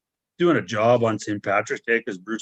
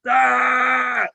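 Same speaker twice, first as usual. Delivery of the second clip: sharp high pained yell, much higher; retold yell of pain; 13 semitones higher, 5 dB louder than usual. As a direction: cry out in pain as if stepped on. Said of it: "And I started yelling".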